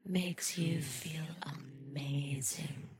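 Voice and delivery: very soft, drawn-out delivery; slow, hushed and soothing; hushed hypnotic suggestion. Acted. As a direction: let each word sink in slowly and softly. Soothing close after trigger chant.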